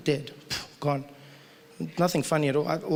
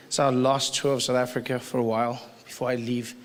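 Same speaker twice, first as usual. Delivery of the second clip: mock American accent; 6 semitones lower, 5 dB quieter than usual. Shown as a demonstration. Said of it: "I'm gonna be talking like this".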